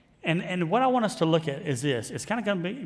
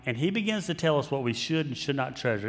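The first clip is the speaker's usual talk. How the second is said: ordinary talk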